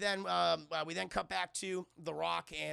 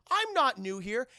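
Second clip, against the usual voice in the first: loud, raised mock offence; indignant protest; 5 semitones higher, 9 dB louder, slower than usual. Guard teased as the new guy, loud indignant denial. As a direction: protest with wounded pride.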